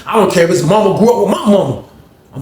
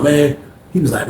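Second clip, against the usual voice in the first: normal talking voice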